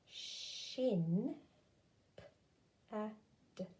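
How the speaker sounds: "Sh-in, p-a-d" split then blended; slow sounding out. Shown as a demonstration. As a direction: sound each part, then join. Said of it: "Let's do this word together".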